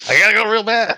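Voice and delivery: high-pitched, loud mock plea; mock urgency. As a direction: act out a desperate need to go. Acts out urgent toilet excuse.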